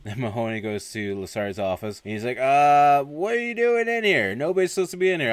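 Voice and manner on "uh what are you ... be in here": high, loud, flustered objection; mock startled protest. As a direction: act out being caught off guard. Retold startled commandant finds an intruder.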